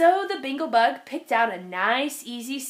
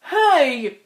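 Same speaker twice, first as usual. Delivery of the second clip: calling out; 7 dB louder than usual. Acted. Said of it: "he called out".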